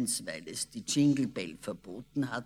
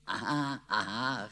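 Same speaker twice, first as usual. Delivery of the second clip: plain reading voice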